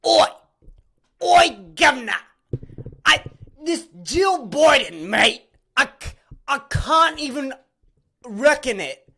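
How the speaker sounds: loud, high mock Aussie newsreader, hammed up; over-the-top fake Australian twang, mocking; mock Australian accent. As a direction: shout it like a cartoon Aussie bloke. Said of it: "have the audacity to come on their news station and go".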